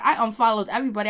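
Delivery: fast, rattled-off quoting Romeo; fast quote. Retold or imitated. Voicing Romeo listing unfollows, rattled off fast. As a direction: rush through it offhand. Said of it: "Romeo was like".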